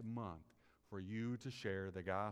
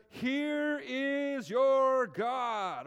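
"Here is your God" shouted, much higher and louder, drawn out slowly; shouted proclamation; 15 dB louder, much slower than usual. Shown as a demonstration. Pastor showing how to proclaim "Here is your God", a slow soaring shout. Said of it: "hopefully you don't say it like that".

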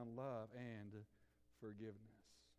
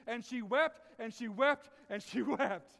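"wept" rising each time, loud and high; repeated for effect; 8 semitones higher, 12 dB louder, slower than usual. Shown as a demonstration. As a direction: hammer "wept" three times with a climbing lift. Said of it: "repeated for effect".

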